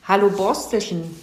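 put-on bright-spirited, delighted greeting to a child; beaming. A narrator's reading. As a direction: greet the little one with delight. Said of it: "strahlte er den Igel an".

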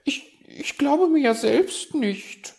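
high, loud flustered stammer; stammering magpie. A narrator's reading. Magpie admitting she disbelieves herself, stammering. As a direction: trip over the first word, then squeak it out. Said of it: "stammelte die Elster".

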